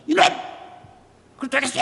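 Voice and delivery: a hint of a stern parent telling off a child; mock scolding. Parent scolding children like a harsh God, mock stern. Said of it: "막 이렇게 야단을 쳐요".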